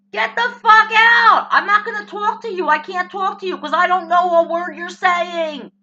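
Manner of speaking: loud, high-pitched telling-off; angry outburst. Acted. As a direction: yell them away in exasperation. Acted rant at foreign speaker.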